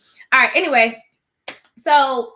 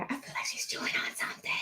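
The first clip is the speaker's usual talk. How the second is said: an unvoiced whispered aside; 12 dB quieter than usual. Real, not acted. Host whispering to viewers about the dog chewing, whispered. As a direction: whisper it so the stream barely hears. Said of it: "whispered aside".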